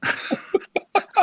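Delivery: breathless giddy laugh; delighted airy squeal; high squealing laugh. Real, not acted. Stunned by Paul's remark.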